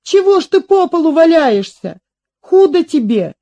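put-on high, urgent girl's pleading; crying out. A narrator's reading. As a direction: urge fallen grandfather up in alarm. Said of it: "закричала она". (высокий встревоженный крик)

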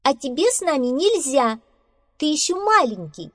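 high, loud brush-off; dismissive squirrel. Acted. Squirrel to little mouse, dismissive. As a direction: wave the little one away, pitched high. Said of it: "отмахнулась от мышонка белка".

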